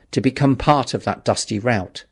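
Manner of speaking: plain reading voice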